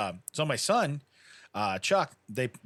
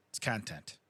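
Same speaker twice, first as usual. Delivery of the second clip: softer, low-key persuasion; deadpan aside; 4 semitones lower, 8 dB quieter than usual. Retold or imitated. Brother-in-law coaxing him for the video, deadpan aside. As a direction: say it quietly, matter-of-fact. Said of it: "he says it just like that".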